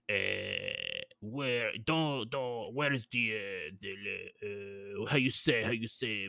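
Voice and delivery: low, halting fake French; attempted French accent. Shown as a demonstration. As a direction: feel for words in mock French. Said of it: "let me try one more time".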